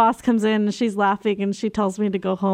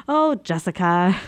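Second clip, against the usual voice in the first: rueful head-shake; 2 semitones lower than usual. Retold or imitated. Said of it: "I said it in that tone of voice".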